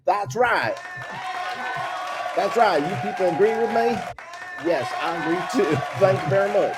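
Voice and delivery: rally an imaginary crowd, half laughing; mock politician. Podcaster playing along with co-host's politician bit, mock speechmaking.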